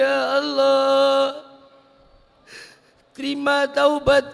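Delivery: high, loud, slow pleading prayer, half sung; weeping prayer. Acted. Preacher praying for forgiveness for the sinners, tearful pleading. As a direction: plead with God through tears.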